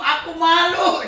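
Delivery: loud, slow, drawn-out cry of shame; hammed-up loud ashamed cry; mock friend's embarrassment. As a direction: ham up a friend crying out in embarrassment. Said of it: "dia ngomong gitu".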